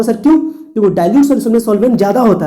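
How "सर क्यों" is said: high, louder student's puzzled sir क्यों; student asking why. Retold or imitated. Teacher imitating a student's question, high and loud. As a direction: voice a curious pupil asking why. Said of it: "कहोगे".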